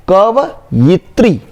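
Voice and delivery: drawn-out "कवयित्री", ka-va-yit-ri, syllable by syllable; slow spelling demo. Shown as a demonstration. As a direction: spell "कवयित्री" out slowly for the class. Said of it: "य में छोटी की मात्रा".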